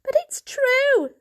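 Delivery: high, loud, slow insistence; insist, voice up high; pleading kitten. A narrator's reading. Kitten insists to the grown-ups, high and loud. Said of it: "said Varjak".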